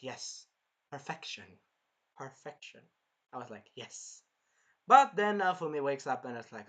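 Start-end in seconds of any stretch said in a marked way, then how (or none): 0.0-4.2 satisfied. Retold or imitated. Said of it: "but I was like".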